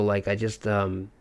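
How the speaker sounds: usual speaking voice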